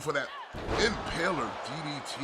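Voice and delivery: excited live play-by-play; wrestling commentator. Acted. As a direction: call the match live. Live play-by-play of ladder match.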